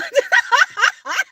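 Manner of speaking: loud, high burst of laughter; real high cackling laugh. Real, not acted. Amused at people having to share one soul, laughing. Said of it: "I'm sorry for laughing".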